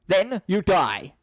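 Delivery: mock deadly threat; menacing threat. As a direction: deliver the death sentence slowly. Johnson threatens them.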